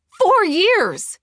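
very high, loud cry; shouted, drawn-out outburst; outraged shout. A narrator's reading. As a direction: explode with betrayed fury. Outburst at betrayal.

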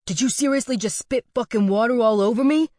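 loud, high, outraged demand; angry question. A narrator's reading. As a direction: jab the finger and yell. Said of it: "the bald guy angrily questioned".

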